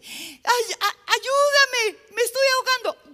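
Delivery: high, loud panicked yelling; drowning cries. Acted. Drowning man to compadre on the pier, panicked shouting. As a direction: stammer into a hammed-up scream for help. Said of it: "el hombre ahogándose".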